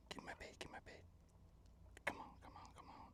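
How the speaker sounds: hushed whisper to the fish. Real, not acted. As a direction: whisper so the fish won't hear. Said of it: "Do I have to whisper?".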